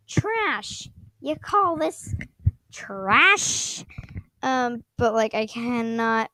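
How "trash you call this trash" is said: higher-pitched, stretched scoffing; mock indignation. Streamer answering friend's trash trade, mock indignation. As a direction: lift and stretch the word in mock offence.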